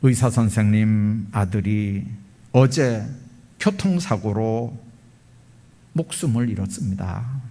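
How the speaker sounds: low, halting, understated grief; tearful disclosure. Retold or imitated. Nurse revealing the surgeon's loss to father, tearful disclosure. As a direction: break sad news haltingly, with long pauses. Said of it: "눈물을 글썽글썽하면서".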